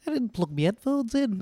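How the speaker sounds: put-on a light northern English accent; mock northern guest admitting a mistake. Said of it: "that and Cockney are my two go-to British accents".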